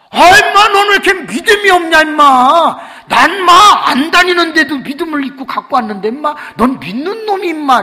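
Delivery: loud, high teasing scold; scolding friend. Retold or imitated. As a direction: berate him for little faith. 친구가 꾸짖음. (친구가 크게 높여 핀잔주듯)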